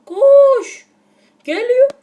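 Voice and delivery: very high, loud panicked scream. Real, not acted. Gamer screaming at his sausage to run from danger.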